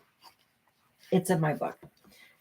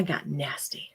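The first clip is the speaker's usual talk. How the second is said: whispered admission; 5 semitones lower, 10 dB quieter, slower than usual. Real, not acted. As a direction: whisper it guiltily. Hushed admission.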